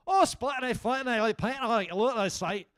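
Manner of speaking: put-on loud, high gibberish in a Scottish accent; mock Scottish like someone drowning; garbled Scottish. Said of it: "Scottish people sound to me like Irish people drowning".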